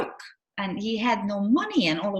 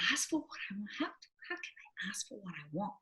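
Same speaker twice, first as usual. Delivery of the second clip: whisper; 16 dB quieter, faster than usual. Retold or imitated. Mocking her own old doubt.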